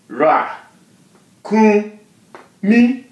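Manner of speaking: Ra, kun, mi in rising steps, split by pauses; syllable by syllable. Shown as a demonstration. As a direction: separate Ra, kun, mi, climbing to high. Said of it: "What you see is pretty much what you get".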